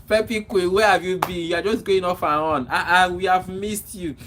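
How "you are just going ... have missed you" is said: ordinary talk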